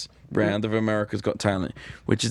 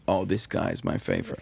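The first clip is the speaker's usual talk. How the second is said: Howard Stern impression; 5 dB quieter than usual. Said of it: "he was like".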